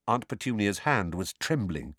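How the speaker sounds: ordinary delivery, nothing marked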